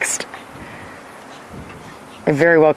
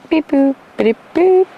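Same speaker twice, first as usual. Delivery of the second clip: robot beep sounds; 12 semitones higher than usual. Pretend. Imitates phone beeping.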